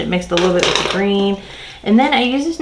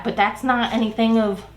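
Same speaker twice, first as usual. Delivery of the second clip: ordinary talk, nothing marked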